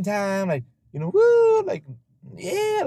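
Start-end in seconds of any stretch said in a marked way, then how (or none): 1.1-2.8 mock party whoop. Mocking Cinco de Mayo partiers.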